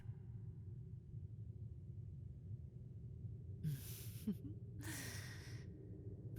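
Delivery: drawn-out airy evil laugh; hushed gleeful cackle over her prey; long whispered villain cackle. Acted. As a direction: let a breathy cackle roll on.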